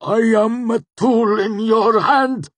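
high, loud, slow cry of pain; tortured wail, straining at the top of the voice; agonized cry. A narrator's reading. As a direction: gasp it out like a wounded animal. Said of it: "he convulsed and cried out, sounding more like a wounded animal".

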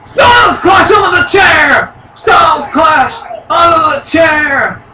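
real excited outburst; excited shout. Real, not acted. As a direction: shout the move out in amazement. Big move onto the chair.

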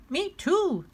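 put-on high little-kid me too; eager little brother. Flop copying his big brother, high and eager. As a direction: copycat toddler.

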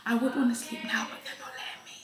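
whispered and tired. Real, not acted.

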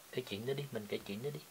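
ordinary talk

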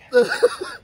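sudden high-pitched squeal of a laugh; a shocked giggle breaking out. Real, not acted. Friends eating gross spicy snack, high squealing laugh. Reacting to disgusting spicy food.

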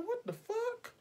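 very high incredulous squeak; stunned disbelief. Retold or imitated. Teller recalling the client scrubbing her face, very high stunned squeak. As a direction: let the pitch fly up in shock. Repeats shocked reaction.

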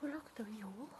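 soft hushed wonder; nervous night vlog whisper. Real, not acted. Hushed discovery in dark ruin.

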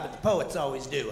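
usual speaking voice

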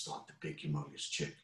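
ordinary delivery, nothing marked

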